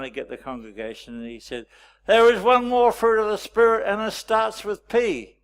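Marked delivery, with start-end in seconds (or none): 2.1-5.4 loud, high-pitched pulpit announcement; preacher's proclamation. Retold or imitated. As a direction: proclaim it to rouse a bored congregation. Retold young preacher rousing bored congregation.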